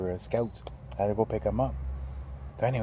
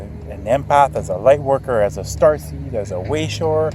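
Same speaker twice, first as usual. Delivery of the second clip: rising and louder, cheering viewers on; rousing encouragement; 4 semitones higher, 4 dB louder than usual. Real, not acted. Encouraging viewers to step forward, rising excited push. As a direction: pump them up, peaking on whatever.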